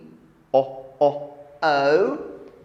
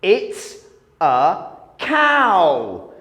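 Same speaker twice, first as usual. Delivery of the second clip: normal talking voice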